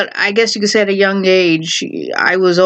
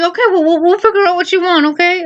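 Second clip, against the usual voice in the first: high-pitched imitation of a mom giving in; quick, lightly voiced parental soothing; placating mom; 9 semitones higher, faster than usual. Mom placates her fed-up child, lightly imitated.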